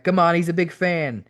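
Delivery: playful, upbeat cajoling; cheery coaxing. A narrator's reading. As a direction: egg a friend on cheerily. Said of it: "Owen cheerily answered back".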